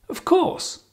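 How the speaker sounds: "Of course" way up high, falling at the end; high pitch intonation. Shown as a demonstration. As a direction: exaggerate the high friendly tune on "Of course". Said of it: "Can you hear the intonation?".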